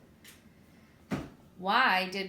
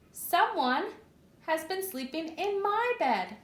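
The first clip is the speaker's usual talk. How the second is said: lightly voiced mother bear, higher; a middle-sized bear voice, a touch raised; Mama Bear's voice; 8 semitones higher than usual. Narrator reading to children as Mama Bear, higher voice.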